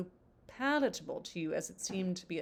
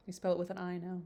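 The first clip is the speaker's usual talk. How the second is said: ordinary delivery, nothing marked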